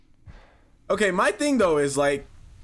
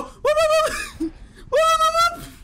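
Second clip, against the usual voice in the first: mock whistle; slower than usual. Can't whistle, so he whoops like a whistle to call the dog. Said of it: "That's my impression of a whistle".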